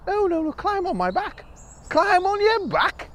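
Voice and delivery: high, coaxing and enthusiastic; high eager bird voice. Acted. Bird coaxes the mouse to come see, high and eager. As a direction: paint the sights high and eager.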